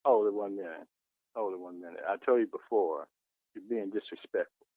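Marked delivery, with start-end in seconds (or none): none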